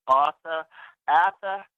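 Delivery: put-on Boston accent. Cousin calling him after seeing his mother, Boston accent. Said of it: "in her Boston accent".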